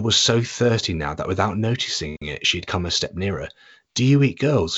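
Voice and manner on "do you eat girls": put-on higher, louder girl's voice; Jill's voice. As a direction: blurt it out as a girl. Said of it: "she said".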